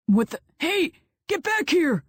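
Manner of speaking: high sudden yell, calling after; shouting. A narrator's reading. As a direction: yell after him to come back. Firefighter yelling.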